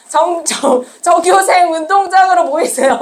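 lightly imitated school assembly announcement. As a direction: mimic calling pupils out to the schoolyard. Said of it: "요즘 조회 이렇게 안 해요". (운동장 조회 안내 방송을 살짝 흉내 내듯)

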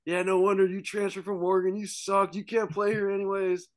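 high, loud mocking heckle; heckling frat kids. Frat kid heckling the quarterback, high mocking jeer. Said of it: "these frat kids are just going at me".

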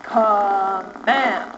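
low, drawn-out commanding chant; slow, low word of command; in charge. Shown as a demonstration. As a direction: stretch it out with firm authority. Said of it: "you're so in charge".

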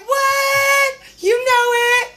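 put-on playful girlfriend banter; excited gushing. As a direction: hype your friend right back. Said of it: "And I was like".

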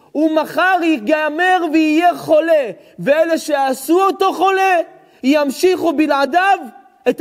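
high, loud fervent sermon; thundering preacher; full-force preaching. Acted. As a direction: preach it hard to the hall.

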